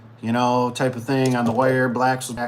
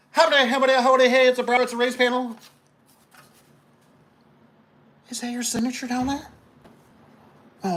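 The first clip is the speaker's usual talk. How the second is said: mock sweet installer; 12 semitones higher than usual. Installer showing customer the signed order, mock sweet voice. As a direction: coo it high at the customer, feigning innocence. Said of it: "And you go".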